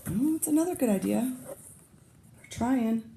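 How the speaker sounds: ordinary delivery, nothing marked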